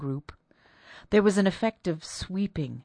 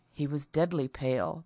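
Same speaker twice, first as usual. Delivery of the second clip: ordinary delivery, nothing marked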